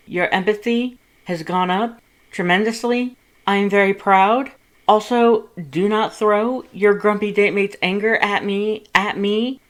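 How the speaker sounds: shrill-free indignant big-brother voice, loud and high; loud, indignant Papyrus. A narrator's reading. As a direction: scold your brother with mock outrage.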